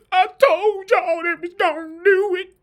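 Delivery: high, slow, whiny mock sob; hammed-up weepy whine; mock crying. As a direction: imitate a crybaby wailing. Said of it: "All I'm saying".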